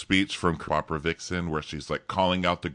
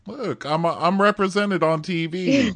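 much higher, a ditzy contestant's voice; mock contestant voice; 12 semitones higher than usual. Mocking a reality contestant, high and proud. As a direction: squeak it out like a proud reality girl.

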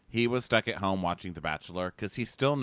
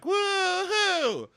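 long, high-pitched whoop; mock whooping cheer. Date reacting to the number of kids, mock cheering. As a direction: let out a drawn-out party holler.

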